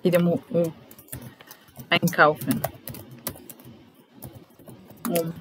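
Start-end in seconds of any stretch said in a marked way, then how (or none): none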